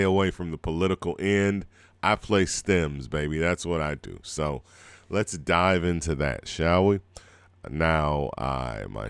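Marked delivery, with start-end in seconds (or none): none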